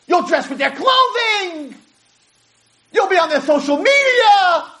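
high, slow chant-like cry, building; impassioned wailing. Retold or imitated. Nations lure the exiled people, voiced in anguished wail. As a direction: cry out each promise, stretched and high.

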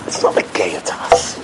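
low, unvoiced whisper; hushed excuse. Speaker echoing the guest's excuse for us, whispered. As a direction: whisper it like a private admission. Same excuse turned on the audience.

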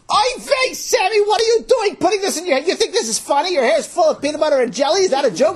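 high, shrieking scolding at full volume; loud, high-pitched maternal tirade; outraged mother. Acted. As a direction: rattle off furious questions in a high yell. Mother scolding, contrasted with amused father.

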